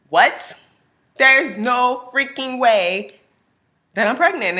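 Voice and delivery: loud, slow, drawn-out disbelief; stunned disbelief. Retold or imitated. Patient refuses to believe the doctor, slow and loud. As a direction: stretch out the shock.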